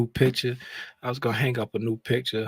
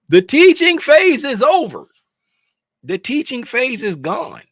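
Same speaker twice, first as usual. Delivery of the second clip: high, loud proclamation; emphatic declaration; 14 semitones higher, 10 dB louder than usual. Real, not acted. Trader tells viewers teaching is over, loud and emphatic. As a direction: slam the point home.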